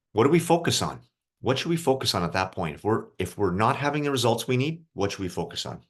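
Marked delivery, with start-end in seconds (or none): none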